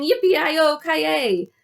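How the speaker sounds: high, loud cowboy whoop; cowboy shout. A narrator's reading. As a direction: whoop it out like a rodeo rider.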